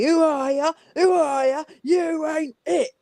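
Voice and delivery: put-on high British terrace chant in a thug accent, slowing into the last line. Caller [name] chanting at the Eagles on Sunday in a British thug accent. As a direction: jeer it like a British football yob. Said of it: "thug English accent".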